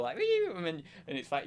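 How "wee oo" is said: high swooping wee-oo; mock video sound flourish, sliding high; sound-effect squiggle. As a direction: whistle the little jingle squiggle. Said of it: "the little squiggle".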